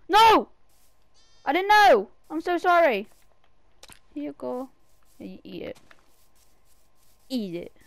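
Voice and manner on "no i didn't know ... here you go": alarmed. Real, not acted. Alarm at cat falling.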